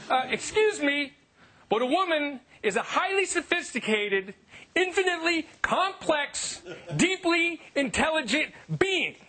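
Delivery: high, slow righteous lecture; mock indignation. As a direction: preach with fake moral outrage. Mock-outraged lecture on women.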